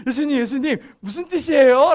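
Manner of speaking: loud, eager calling out; eager disciples. Retold or imitated. Disciples chasing after Jesus to ask his meaning, loud and eager. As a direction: run up bursting with a question. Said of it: "예수님한테 쫓아가가지고".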